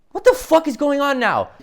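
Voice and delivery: high, loud, incredulous demand; exasperated bafflement. Acted. Viewer lost by the movie's sudden twist, exasperated. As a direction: blurt it out, totally lost.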